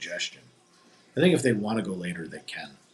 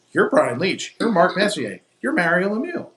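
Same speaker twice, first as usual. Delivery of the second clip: starstruck excitement; 8 semitones higher, 6 dB louder than usual. Retold or imitated. Starstruck fan greeting the players.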